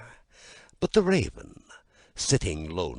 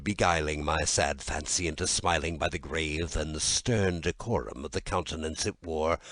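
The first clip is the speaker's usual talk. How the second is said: ordinary delivery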